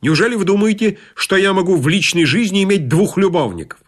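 high-pitched, haughty indignation; wrathful queen. A narrator's reading. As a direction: rebuke like an offended queen. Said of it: "с видом разгневанной королевы".

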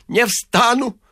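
high-pitched wailing refusal; stubborn priest. A narrator's reading. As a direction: refuse in a high, pleading cry. (упрямо, высоким голосом)

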